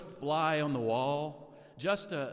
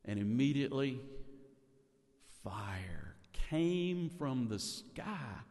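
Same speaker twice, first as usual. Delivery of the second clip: normal talking voice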